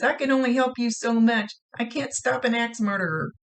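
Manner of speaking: worried warning. Retold or imitated. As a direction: throw up your hands at the danger.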